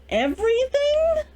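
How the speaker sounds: high, drawn-out everything; incredulous rising question; mock baffled. Recapping the exes' fight, incredulous.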